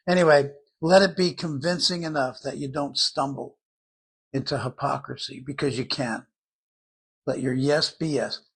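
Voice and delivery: ordinary delivery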